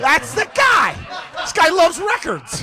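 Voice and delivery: recognise him on TV and yell; mock excited outburst; excited shout. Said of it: "and he goes".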